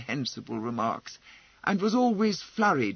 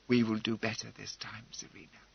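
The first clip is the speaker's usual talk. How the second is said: soft promise; 5 semitones lower, 6 dB quieter, faster than usual. A narrator's reading. Tender promise, fingers closing hers.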